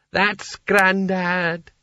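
very slow, high, delighted; happy sultan. Acted. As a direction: beam with pride over grandad. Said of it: "said Creosote happily".

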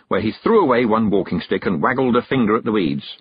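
plain reading voice

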